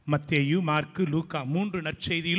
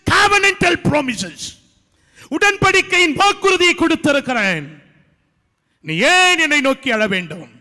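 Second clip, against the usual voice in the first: full-force preaching; 13 semitones higher, 10 dB louder than usual. Acted. Pastor voicing God's rebuke to crying Moses, loud preaching. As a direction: proclaim it like God rebuking Moses.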